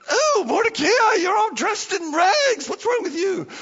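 high, loud, hammed-up English accent; Esther, English accent. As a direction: exclaim in a put-on English lady's accent. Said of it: "Sorry about the English accent".

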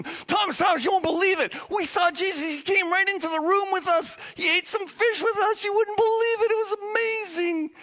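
high, eager voices; excited telling. Acted. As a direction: burst with the news. Disciples tell Thomas excitedly.

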